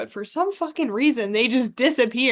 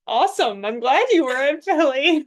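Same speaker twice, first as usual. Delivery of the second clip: loud, higher put-on cheerful reply; mock enthusiasm; 4 semitones higher, 7 dB louder than usual. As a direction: play up being glad for them. Mock reply to the crowd member.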